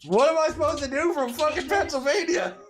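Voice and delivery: high, loud helpless cry at the dogs; exasperated yell. Real, not acted. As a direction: throw up your hands and yell it up high. Helpless far away, dogs misbehaving.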